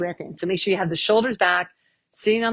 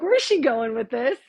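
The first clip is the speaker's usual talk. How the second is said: high, teasing voice; light mock of the listeners; audience voice; 6 semitones higher than usual. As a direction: voice the puzzled audience, playfully. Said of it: "You guys are like".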